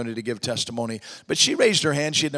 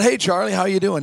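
bright, loud, high hello; loud eager greeting; 7 semitones higher, 9 dB louder than usual. Retold or imitated. Preacher greeting a huge stranger, loud and eager. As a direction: greet the giant loudly and eagerly.

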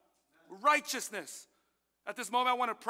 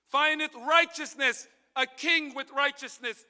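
slow preaching; 6 semitones higher, 8 dB louder, slower than usual. Acted.

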